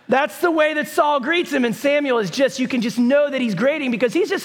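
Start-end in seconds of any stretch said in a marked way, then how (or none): none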